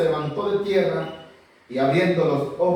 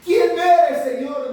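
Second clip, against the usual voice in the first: Paul crying out; 9 semitones higher, 7 dB louder, slower than usual. Acted. Paul asks again.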